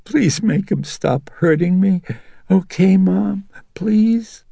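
put-on very high, pleading, tearful little girl; sniffling plea. A narrator's reading. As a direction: beg mother to make it stop, sniffling.

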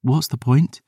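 a hint of despair; bleak maid. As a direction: ask with nothing left. Isla bleak.

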